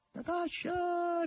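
calling out very high and drawn out; a long, shrill-high call across the woods; high drawn-out call to Natasha; high-pitched cry. A narrator's reading. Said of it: "gridò Margherita con voce acuta".

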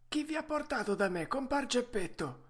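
vocina acuta da vecchio falegname; mastro falegname. Acted. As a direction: rispondi in tono molto acuto, da anziano artigiano. (vocetta alta del falegname)

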